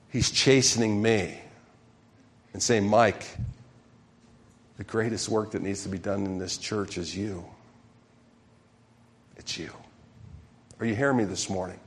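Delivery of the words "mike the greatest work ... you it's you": much softer, hushed words from God; God's quiet voice. Retold or imitated. God gently speaking to pastor Mike, soft and hushed.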